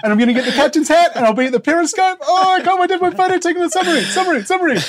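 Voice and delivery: put-on high-pitched, fast, gushing like an overexcited kid; Scott Morrison, excited. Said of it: "how excited he is".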